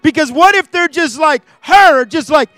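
high, loud pulpit fervor; rising, forceful sermon delivery; impassioned preaching. Real, not acted. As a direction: preach it hard, hitting the key words.

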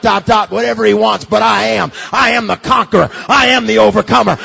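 loud, building preacher's cry; full-force preaching. Acted. Preacher rousing the congregation to a loud climax. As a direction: proclaim it to the rafters.

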